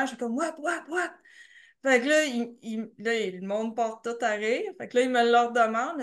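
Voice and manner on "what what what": flustered, higher-pitched mock panic; keyed-up, rattled blurting; nervous. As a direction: act out jittery confusion at a question. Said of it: "j'étais toute sur le gros nerf".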